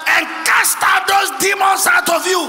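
high, very loud shouted sermon; impassioned pulpit shout; full-force preaching. Real, not acted. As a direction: thunder it at the congregation, pitched high.

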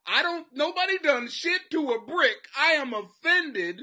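high, loud mocking whine; mock a prissy offended viewer. Imitating a viewer offended by cartoons, high mocking whine. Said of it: "cartoons going".

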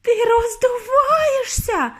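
very high, slow, wailing shout; horrified shriek. A narrator's reading. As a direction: stretch the scream out in horror. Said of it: "знову крикнула пані Борегард".

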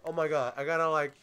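usual speaking voice

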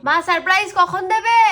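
loud, high call to another room. Real, not acted. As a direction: shout to mom in the kitchen. Calling out to mother. (মাকে জোরে ডাকা)

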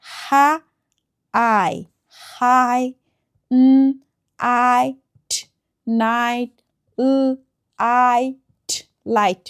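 হাই, নাইট, লাইট sounded out in parts, then blended; long I. Shown as a demonstration. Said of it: "আই sound করে". (হাই, নাইট, লাইট ভেঙে ভেঙে উচ্চারণ)

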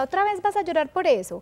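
mother imitating a disrespectful scolding; exasperated parent. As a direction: snap at the crying kid, mock-annoyed. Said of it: "No que yo le diga".

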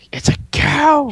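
high, slow delighted cry; excited. Real, not acted. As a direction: squeal at spotting the cow. Spots a cow in game.

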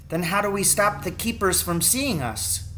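worried son, voice lifted. A narrator's reading.

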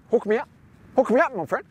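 high, bright coaxing ask; playful plea. Pretend. Asking universe playfully.

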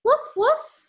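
high yappy puppy woof; puppy bark. Acted. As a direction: bark like a small happy dog. Dog barks in the story.

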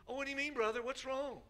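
high, lightly voiced puzzled brother; mock puzzled brother. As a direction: play the confused churchgoer. Acted church dialogue.

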